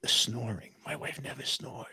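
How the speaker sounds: hushed, soft secret; whispering. Real, not acted. Husband telling the host about his wife's snoring, hushed. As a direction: lean in and keep it quiet. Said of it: "I'm whispering".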